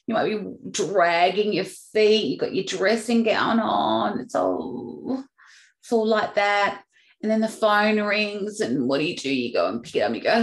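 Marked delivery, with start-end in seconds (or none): 4.2-6.8 sick groan. Retold or imitated. Sick at home in dressing gown.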